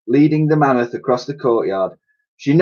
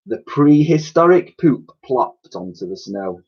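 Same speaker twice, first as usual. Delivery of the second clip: plain reading voice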